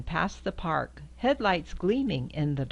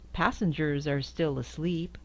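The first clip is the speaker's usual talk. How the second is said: ordinary delivery, nothing marked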